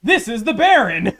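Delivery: high loud incredulous retelling; excited. Retold or imitated. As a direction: retell it in disbelief.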